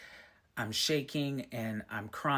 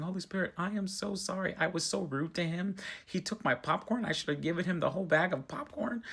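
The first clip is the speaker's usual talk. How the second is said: higher, pleading retold sorry; retold apology; 6 semitones higher, 3 dB louder than usual. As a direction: reenact begging forgiveness, a bit hammed-up. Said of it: "I started apologizing to Holy Spirit".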